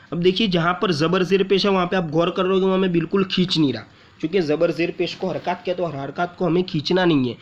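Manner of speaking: ordinary talk, nothing marked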